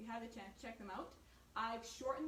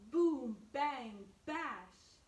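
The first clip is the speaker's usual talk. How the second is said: loud drum sounds; 9 dB louder, much slower than usual. A narrator's reading. Bear bashing the drums.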